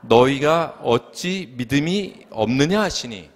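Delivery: drawn out, 없느냐 lifted and stretched at the end; 없느냐 stretched with a rising tune; slow scripture reading. Shown as a demonstration. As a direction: read 없느냐 slowly with a long rise, in unison with the church. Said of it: "다 같이 읽어볼까요? 시작".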